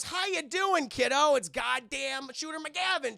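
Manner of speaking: loud, high salesman-like hello; hammed-up visitor voice; overhearty greeting. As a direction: greet the kid far too heartily. Visitor's greeting at the door, then named.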